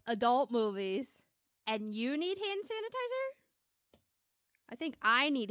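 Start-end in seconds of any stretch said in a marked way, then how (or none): 1.7-4.0 incredulous mock. Mocking rude customer's request.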